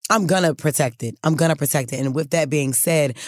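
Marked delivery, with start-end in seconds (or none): none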